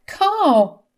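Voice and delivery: high, loud pleading wife; desperate wife's appeal, high and pressing; disbelieving plea. A narrator's reading. Wife begs husband not to divorce.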